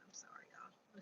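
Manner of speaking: breathy whispered aside; whispered apology. Real, not acted. Apologizing live on stream.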